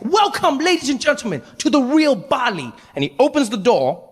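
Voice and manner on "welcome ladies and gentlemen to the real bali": much higher, shouting guide welcome; overeager tour guide. Retold or imitated. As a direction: greet them like a way too happy guide. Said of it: "he's like".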